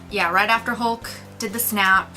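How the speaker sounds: ordinary talk, nothing marked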